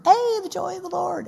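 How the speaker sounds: hammed-up sitcom character impression; Goober. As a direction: put on a goofy, high-pitched happy voice. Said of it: "I do it in his voice".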